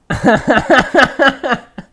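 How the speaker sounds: sudden loud high laugh; loud laugh burst. Real, not acted. Erupts at the spill.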